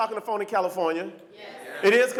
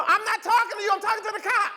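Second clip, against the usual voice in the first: high voice to floor phone; 14 semitones higher than usual. Retold or imitated. Talking to dropped phone.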